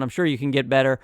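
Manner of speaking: ordinary talk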